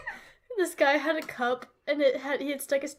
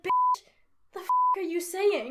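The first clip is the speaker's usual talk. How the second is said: high, loud incredulous outburst; sassy, raised-voice disbelief; exasperated outburst; 6 semitones higher, 4 dB louder than usual. Acted. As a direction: call them out, loud and appalled. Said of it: "I'd be like".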